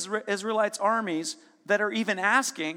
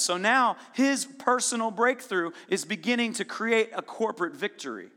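ordinary talk

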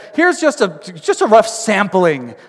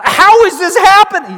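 high, loud burst of acted bewilderment; astonished; 12 semitones higher, 8 dB louder than usual. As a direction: cry out in disbelief. Acting a stunned bystander.

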